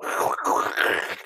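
wet, rasping suction sound; imitated suction noise. Wife retelling how the suction cleared his throat, imitated gurgle. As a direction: imitate a suction tube slurping up spit. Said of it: "you heard it just go".

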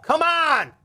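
ordinary talk, nothing marked

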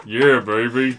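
put-on a low, laughing "yeah, baby"; deep grandpa voice.

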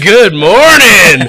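high, loud, drawn-out holler; whooping exclamation. Real, not acted. Drinker jolted by rum, hollering slowly. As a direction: stretch it out like a boozy wake-up call.

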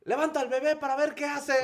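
high mock call across the rows; hammed-up call to a relative. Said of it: "pero grita como". (imitando al señor que grita, agudo)

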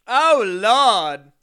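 loud, hammed-up exclamation; mock exclamation. Co-host reacting to an impression, loud mock dismay.

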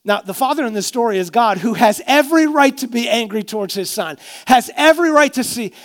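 fervent preacher climbing in force; preaching. Acted. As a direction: drive the point home from the pulpit. Preaching builds toward the rebuke.

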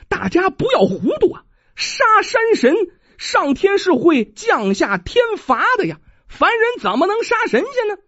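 high, pressing appeal to the crowd; urgent plea. Acted. Woman warns the crowd against killing a god, urgent. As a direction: warn them urgently.

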